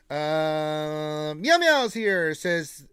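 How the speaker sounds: ordinary talk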